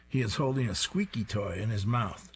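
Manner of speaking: ordinary delivery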